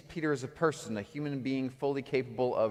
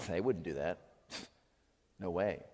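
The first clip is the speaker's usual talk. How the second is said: skeptical inner thought; 8 semitones lower, 8 dB quieter than usual. Acted. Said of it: "part of you is thinking".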